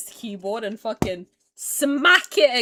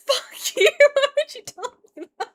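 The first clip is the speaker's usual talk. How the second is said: high, squealed protest with a laugh; exasperated outrage; 7 dB louder than usual. Real, not acted. Streamer told about wings she lacks, exasperated. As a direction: squeal it fast, half laughing. Upset at viewer's news.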